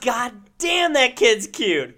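loud, high-pitched cry of delight; gushing outburst. Retold or imitated. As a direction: gush it, stretching the words out. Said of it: "I was like".

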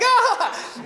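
very high mock shriek with a laugh; a laughing squeal of shock; hammed-up startled cry; startled yelp. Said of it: "And you're like".

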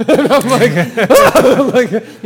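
loud, high laughter breaking through; laughing. Real, not acted. Laughing as he retells the operator's quarter demand. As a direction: laugh hard while telling it.

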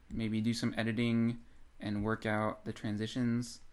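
ordinary talk, nothing marked

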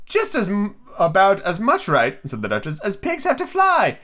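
put-on very high, slow, prim old lady; Duchess voice. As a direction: hold the Duchess's high sing-song. Duchess voiced in read story.

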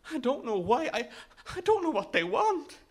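high, distressed stammer; frightened boy losing control; panicked. A narrator's reading. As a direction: protest helplessly, the pitch climbing.

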